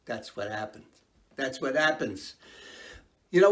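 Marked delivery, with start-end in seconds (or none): 0.1-0.8 soft aside. Shown as a demonstration. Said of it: "yeah, see?".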